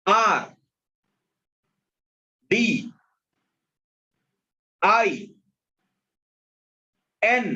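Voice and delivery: each letter called out alone, high and spaced; slow classroom dictation, one letter at a time. Acted. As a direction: call out each letter clearly for the class to copy.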